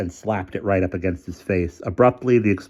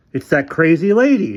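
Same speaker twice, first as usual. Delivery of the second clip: higher, louder shout; shocked shout; 10 semitones higher, 8 dB louder than usual. A narrator's reading. Developer shouting after the shotgun blast, higher and louder. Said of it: "shouted the developer".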